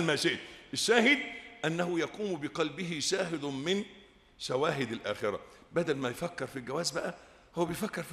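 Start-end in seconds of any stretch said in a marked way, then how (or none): none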